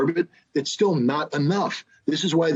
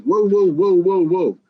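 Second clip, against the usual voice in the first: loud whoa; 5 semitones higher, 5 dB louder than usual. Retold or imitated. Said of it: "And Biden said".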